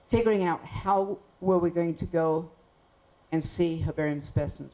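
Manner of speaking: ordinary delivery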